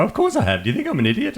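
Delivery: mock outrage from a caller insulted by the question; indignant caller. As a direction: customer indignantly snapping back at the help desk. Irritated caller retorts.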